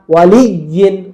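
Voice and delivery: ওয়ালিয়িন slowly, leaning hard on লিয়ি; ওয়ালিয়িন with a weighted middle; force on doubled ya. Shown as a demonstration. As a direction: press and hold the doubled y in ওয়ালিয়িন. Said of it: "একটা জোল দিতে হয়".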